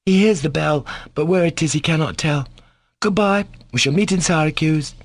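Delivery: put-on high-pitched cheeky boy's voice; insolent schoolboy. A narrator's reading. Schoolboy taunts the doctor as he leaves, insolently. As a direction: toss out a pert, taunting farewell.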